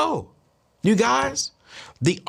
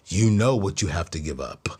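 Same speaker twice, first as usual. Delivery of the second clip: low, mock-mysterious pronouncement; low put-on psychic voice; psychic's odd voice; 11 semitones lower than usual. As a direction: imitate a knowing fortune-teller, pitched down. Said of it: "Why are you talking like that?".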